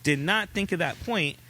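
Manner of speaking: husband protesting he isn't being sarcastic; eager denial. Retold or imitated. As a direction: protest at once that you mean it. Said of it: "And I'm like".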